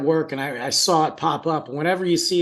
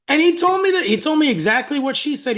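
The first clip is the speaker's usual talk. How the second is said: excited; 11 semitones higher, 4 dB louder than usual. Real, not acted.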